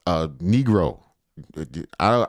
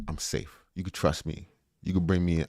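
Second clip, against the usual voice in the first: soft, hushed, reassuring; mock reassuring; 5 semitones lower, 9 dB quieter than usual. Streamer reassuring viewers at work in a soft, hushed voice. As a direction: coax them like a friend.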